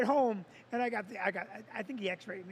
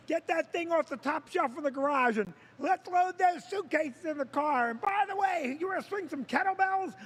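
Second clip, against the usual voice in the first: bossy wife voice, higher, rising to a shout; mocking Vicky; 4 semitones higher than usual. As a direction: pile on chores and end yelling. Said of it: "Vicky's like".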